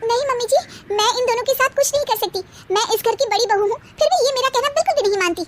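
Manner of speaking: elder daughter-in-law protests to mother-in-law, high and hurried; elder daughter-in-law protesting. Acted. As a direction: blurt out a grievance to mother-in-law. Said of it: "बड़ी बहू बोल उठती है".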